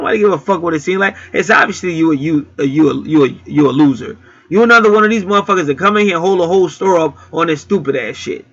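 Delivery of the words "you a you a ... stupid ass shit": ordinary talk, nothing marked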